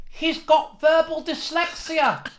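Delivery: high, slow, far-off posh heckler voice; mocking heckler. Heckler mocking the stammering comic, slow high jeer. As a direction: jeer it slowly in a put-on voice. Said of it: "This fellow stood up and he went".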